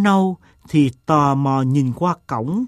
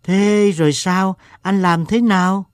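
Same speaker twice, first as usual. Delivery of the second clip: higher-pitched, curious questions; eager questioning; 6 semitones higher than usual. A narrator's reading. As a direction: clamour to hear what happened next. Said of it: "họ đua nhau hỏi".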